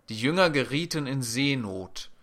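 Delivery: normal talking voice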